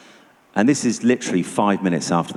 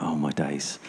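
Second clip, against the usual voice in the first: hushed fearful whisper; 5 semitones lower, 9 dB quieter than usual. Retold or imitated. Recalling fear at the hotel.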